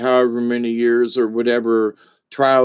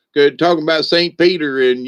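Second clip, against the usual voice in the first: usual speaking voice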